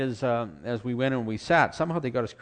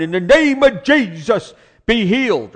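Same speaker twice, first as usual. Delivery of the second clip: much higher, loud and slow "be healed"; hollering prayer; 10 semitones higher, 13 dB louder, slower than usual. Retold or imitated. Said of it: "he's hollering".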